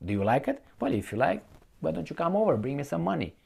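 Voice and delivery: ordinary talk, nothing marked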